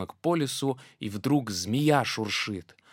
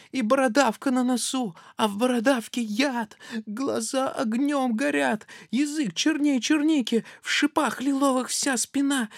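high, loud squeaky alarm; a mouse panicking at the beast; frightened mouse; 12 semitones higher, 6 dB louder than usual. Acted. Mouse terrified at the gruffalo, high and loud.

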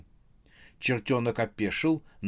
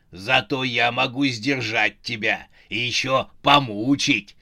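menacing put-on voice, no real laugh; gloating villain. A narrator's reading. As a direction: relish the threat of torment. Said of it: "разразился хохотом Велес". (злорадно, с угрозой)

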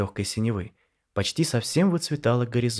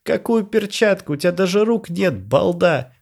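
snorting with laughter; 9 semitones higher, 7 dB louder than usual. A narrator's reading. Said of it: "прыснул Жора".

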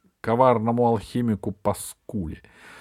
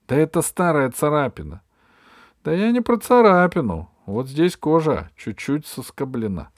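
raised, insistent voice; animated argument; 6 semitones higher, 5 dB louder than usual. Acted. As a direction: argue it eagerly. Children bickering over scratch.

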